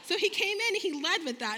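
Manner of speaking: ordinary delivery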